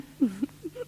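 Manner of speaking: high light laugh; high amused chuckle. Acted. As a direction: laugh at your own charm. Laugh after flirting.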